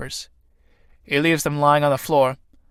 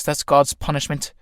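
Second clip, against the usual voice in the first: ordinary delivery, nothing marked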